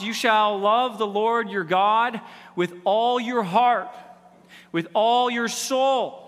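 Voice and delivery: slow and weighty, each phrase leaned on; solemn slow quote. Acted. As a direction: intone the commandment slowly. Quoting the greatest commandment.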